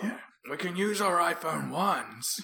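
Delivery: high, slow put-on voice; mock survivor voice. Podcaster in ruins to partner, mock survivor voice. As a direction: play the hopeful survivor, hammed-up. Acting survivor of robot takeover.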